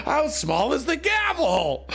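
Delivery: high shout through laughter; laughing shout. Real, not acted. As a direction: yelp it mid-laugh. Laughing at chat's joke.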